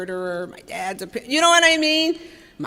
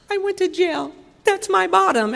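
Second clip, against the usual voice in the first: mocking quote; 9 semitones higher than usual. Mimicking sponsees' jail stories.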